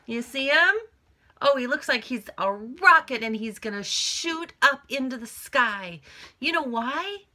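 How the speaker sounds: hammed-up thrilled voice; excited. Shown as a demonstration. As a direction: bubble up like a launching rocket. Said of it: "he's excited".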